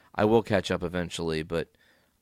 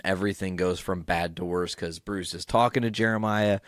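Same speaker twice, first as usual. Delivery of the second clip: ordinary talk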